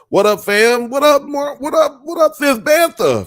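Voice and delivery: loud, high hype shout-out; hyped greeting. Real, not acted. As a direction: yell out to a viewer.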